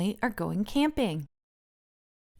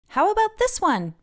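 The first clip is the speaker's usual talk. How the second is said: high, louder eager question; Francine offering eagerly; 12 semitones higher, 4 dB louder than usual. A narrator's reading. Francine offering Olivia a stick, high eager question. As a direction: ask hopefully, pitched way up. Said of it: "Francine asks".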